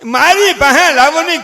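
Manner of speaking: high, loud mock granny demand; hammed-up insistent grandmother; fussing grandmother. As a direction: clamour for the baby, high and loud. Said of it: "grandmother ગૌટીમાં".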